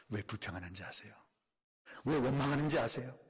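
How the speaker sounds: usual speaking voice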